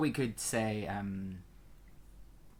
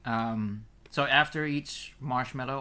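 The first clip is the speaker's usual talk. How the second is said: ordinary delivery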